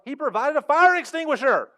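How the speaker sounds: fed-up guy pointing at the extinguisher, exasperated mock shout. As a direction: yell in disbelief, high and loud. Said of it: "And he's like".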